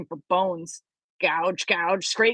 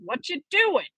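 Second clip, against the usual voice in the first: calling out; 6 semitones higher than usual. Acted. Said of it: "he called".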